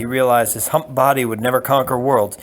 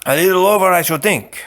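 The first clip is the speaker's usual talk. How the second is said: a hint of the swordsman, higher and louder; easy, wry reply; lightly voiced Spaniard; 7 semitones higher, 5 dB louder than usual. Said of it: "said the Spaniard".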